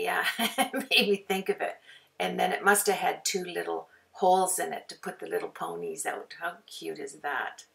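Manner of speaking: ordinary delivery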